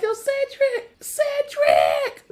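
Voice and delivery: high hammed-up call. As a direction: yell for Cedric across the house. Steve calling out in panic.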